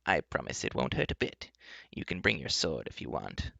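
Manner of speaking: lightly voiced enchantress, low and soft; a touch of soft, low reassurance; cheerful enchantress. Enchantress reassuring the companions, mildly cheerful. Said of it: "the enchantress said cheerfully".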